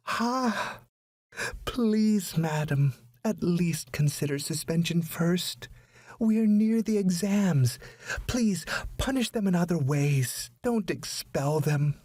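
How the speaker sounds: high, anguished begging; pleading. A narrator's reading. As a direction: plead desperately on her knees. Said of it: "pleadingly addressed the principal".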